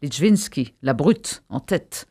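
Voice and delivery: usual speaking voice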